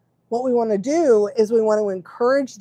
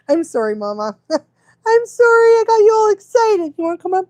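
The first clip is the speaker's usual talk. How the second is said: high, loud cooing apology with a laugh; 10 semitones higher, 6 dB louder than usual. Real, not acted. Owner apologizing to her excited dog, high cooing.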